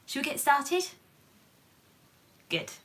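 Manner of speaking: usual speaking voice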